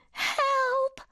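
high squeaky bird cry for help; plover crying out. Acted. Little plover calls to the builders, high squeaky cry. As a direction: cry out like a tiny frightened bird. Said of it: "cried the plovers".

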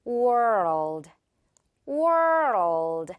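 world, slow, R curling into L. Shown as a demonstration. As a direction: stretch world, tongue lifting to L. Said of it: "혀 지금 선생님의 혀 느껴지나요". (world를 천천히 굴려서)

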